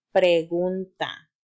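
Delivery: pregunta said slowly and clearly, every syllable shaped; slow model word. Shown as a demonstration. Teacher models pregunta for children to repeat, slow and clear. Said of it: "repite conmigo".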